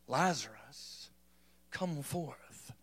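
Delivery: very low, slow and soft, fading to a whisper; mock dignified murmur. Shown as a demonstration. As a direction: overdo the restraint, drawn out and hushed. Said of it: "Think that's how he said it?".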